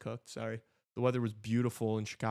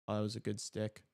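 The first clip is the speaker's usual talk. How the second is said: usual speaking voice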